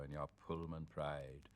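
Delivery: plain reading voice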